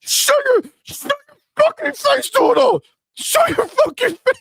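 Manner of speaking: high, loud mock tantrum at Doodle; mock ponies raging. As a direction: shriek like a furious fanboy yelling at a friend. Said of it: "I could just imagine the ponies right now".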